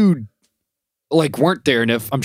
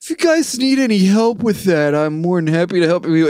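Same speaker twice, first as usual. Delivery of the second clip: high, mock eager offer; way too helpful, lifted up high; sing-song keen client voice; overeager helper; 9 semitones higher than usual. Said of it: "He goes".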